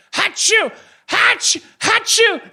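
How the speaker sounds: very high, loud cartoon sneezes that build to a shout; imitated sneezes. Imitating the guy who kept sneezing on the boat, loud mock sneezes. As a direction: blast out three mock sneezes. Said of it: "kept sneezing".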